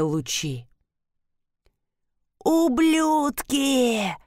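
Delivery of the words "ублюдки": shrill drawn-out shriek. Acted. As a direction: shriek it like a kicked dog. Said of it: "заверещал убийца".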